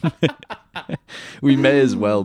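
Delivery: laughing through the words. Real, not acted. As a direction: chuckle it out. Joking Vietnam veteran bit.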